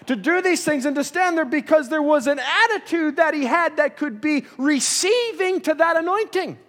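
高く力強く盛り上がる説教; building fervour, voice climbing and pressing; rising preaching. Acted. Preacher builds to full force.

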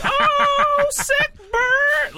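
very high, loud hammed-up whoop; over-the-top celebration. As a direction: shout it as if dancing over a great burn. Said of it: "got up and started dancing".

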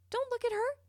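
a sharp, pleading warning; emphatic repeat. Acted.

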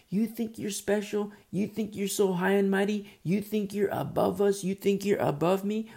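high, repetitive mock taunt; accusing claims. Carnal people accusing the faithful, mock taunting. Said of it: "they will make these claims".

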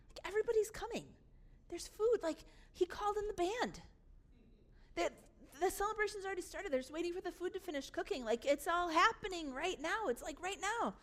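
high-pitched, eager report of a party; bubbling over with news; excited news-bearer. Acted.

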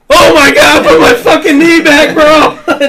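high, loud mock panicked yell; hammed-up screaming friend in pain; imitating friend's screaming. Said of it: "he just, like".

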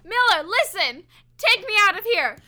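high, loud pleading cry; desperate yell. Acted. Terrified prisoner yells to be let out of the cell. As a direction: beg to be let out at full voice.

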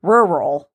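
"Rural" with R's turned to w, wural; R's slide into w in "Rural"; says w for r. Real, not acted. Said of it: "couldn't say my R's".

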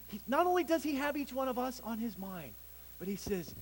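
ordinary delivery

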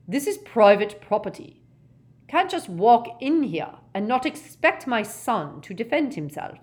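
loud, high, belligerent rant; angry property owner's challenge; aggressive. Acted. Gaunt warning off the intruder, aggressively. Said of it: "said Gaunt aggressively".

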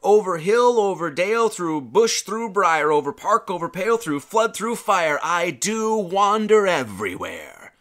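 loud, lilting recital of the verse, slowing on the last line; poem recital. Acted. Preacher opens the sermon with a verse, declaimed loudly. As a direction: declaim it like a bard opening a show.

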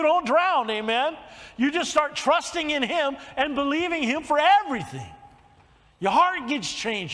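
fervent preaching. Real, not acted. Preacher urges congregation to trust God, fervent and loud. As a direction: preach it out with building force.

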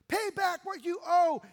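loud, high, harsh demand for payment; angry demand. Acted. Servant choking his debtor, furious loud demand. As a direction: bark it at the debtor like a creditor. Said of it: "he demanded".

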